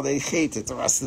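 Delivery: ordinary talk, nothing marked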